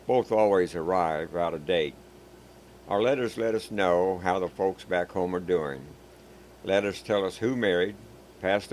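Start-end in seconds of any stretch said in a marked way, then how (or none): none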